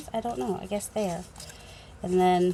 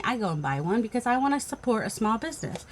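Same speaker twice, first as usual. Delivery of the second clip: usual speaking voice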